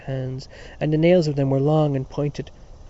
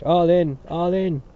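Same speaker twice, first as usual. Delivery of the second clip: louder, higher calls echoing the first; echoing playground calls; calling out; 4 semitones higher, 5 dB louder, slower than usual. Acted. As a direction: join the call across the playground. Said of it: "And other voices cried".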